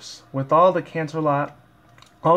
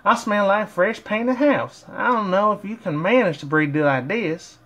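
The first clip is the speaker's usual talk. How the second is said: much higher, slow put-on pony voice; Applejack voice; 7 semitones higher than usual. Fan voicing Applejack complaining of her smell, high and slow. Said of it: "Applejack frowned".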